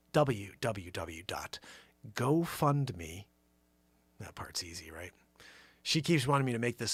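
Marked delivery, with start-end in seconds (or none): 0.1-3.3 slow, whispered web address. Shown as a demonstration. Host reading the GoFundMe link slowly for listeners. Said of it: "I'm gonna say it slow this time". 4.2-5.1 soft whispered aside. Shown as a demonstration. Host whispering to listeners while giving the link. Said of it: "I'm gonna say it slow this time".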